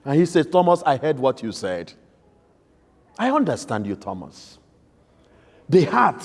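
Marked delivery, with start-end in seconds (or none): 0.5-4.6 tender, soft comfort. Acted. Jesus gently comforting a troubled Thomas, softly. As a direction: soften down to almost nothing on Thomas.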